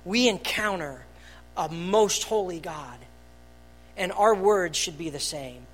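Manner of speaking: ordinary talk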